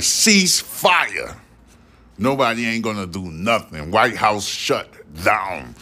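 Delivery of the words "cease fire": high pitched barked order; commanding creator. Pretend. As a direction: order everyone to stop, pitched up. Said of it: "That bitch gonna be like".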